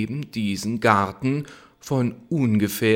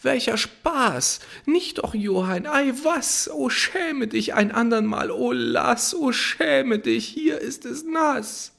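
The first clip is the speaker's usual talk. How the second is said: put-on much higher girlish voice, half protest; coy girl protesting; 14 semitones higher than usual. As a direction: play the coy maiden fending him off. Hanne protests coyly. (hohe, kokett protestierende Mädchenstimme)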